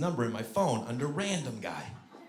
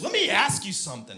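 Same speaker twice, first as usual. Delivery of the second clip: mock irate woman, pitched up; pissed off; 7 semitones higher than usual. Marie challenging the prank caller, pissed off. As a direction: imitate an annoyed woman challenging someone. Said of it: "Marie got pissed".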